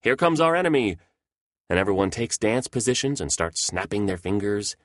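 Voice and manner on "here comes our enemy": high alarmed yell; a high mock yell of alarm. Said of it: "his right-hand man yells".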